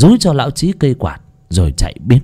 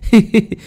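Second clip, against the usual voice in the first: a high, loud belly laugh of mockery; loud mocking guffaw; 11 semitones higher than usual. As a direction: laugh out loud at the old man. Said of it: "cười ha hả".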